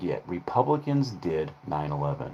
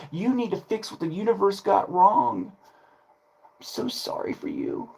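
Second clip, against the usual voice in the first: mock pity; 10 semitones higher than usual. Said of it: "people going".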